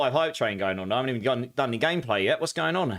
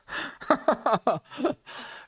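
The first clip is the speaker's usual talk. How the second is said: a long squealing laugh, rising higher; pained high laugh; 11 semitones higher than usual. Real, not acted. Streamer laughing through wasabi pain before thanking subs. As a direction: laugh helplessly through the burn.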